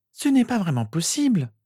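put-on high girl's voice, firm; assertive sister. A narrator's reading. Sister answering Harry's question about proof, assertive. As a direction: state it firmly in a high voice. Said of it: "affirma-t-elle".

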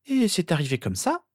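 put-on high-pitched girl's voice, doubting; skeptical sister. A narrator's reading. Sister questioning her brother's story, skeptical.

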